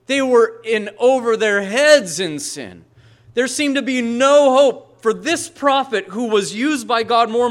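the pastor building to a thundering point; full-force preaching. Acted. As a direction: preach it out with rising fire.

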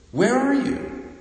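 sudden high wailing cry; loon wail. Retold or imitated. Preacher echoing the loon's plaintive plea, high wail. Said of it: "our plaintive plea".

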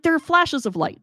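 high-pitched acted insistence; insistent protest. Telling doubting Gary about lights in the basement, insistent. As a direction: argue it to someone who doubts you.

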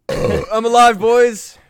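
loud, slow triumphant cheer. Real, not acted. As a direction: announce it like a victory. Triumphant after good results.

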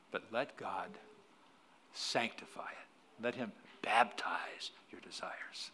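slow, hushed whisper; slow tender whisper. Real, not acted. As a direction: let it fall to a whisper. Closing appeal, whispered.

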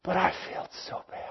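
hushed, quick, voiceless whimper; mock tearful regret. Peter mourning his failure, hushed and weepy. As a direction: whisper it as if weeping with shame.

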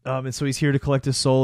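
ordinary delivery

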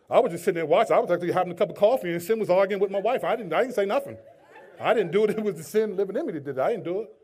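loud, hammed-up defensive alibi; mock-innocent protest. Bystander claiming sin argued with his wife, comic defensive protest. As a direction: plead innocence loudly, playing it for laughs.

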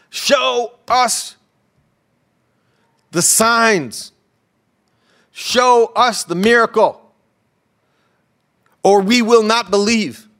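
high, loud, drawn-out demanding; insistent crowd demand. Retold or imitated. Acted crowd demanding signs of Jesus.

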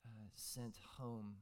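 hushed pastoral prayer; quiet prayer. Real, not acted. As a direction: pray gently to the congregation.

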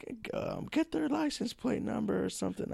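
high, soft bystander voice. Retold or imitated. Onlookers urging someone to get the plate number, high and soft. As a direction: lightly voice a worried onlooker. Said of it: "some people were like".

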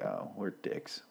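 hushed, slow whisper. Retold or imitated. Bruce admits their mistake in a slow whisper. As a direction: breathe it out in shame. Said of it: "And he's like".

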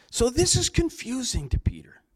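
breathy confidential whisper; whispered aside. Acted. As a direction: lean in and whisper it to the congregation. Pastor drops voice for emphasis.

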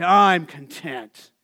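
mock tattoo motto, slow and firm; slow content declaration. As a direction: say it slowly like a proud motto.

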